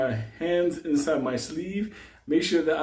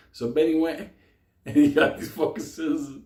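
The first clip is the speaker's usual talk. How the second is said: ordinary talk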